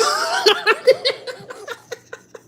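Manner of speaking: cracks up hard at his own line; a big high giggle, helpless; high, far laughter. Real, not acted.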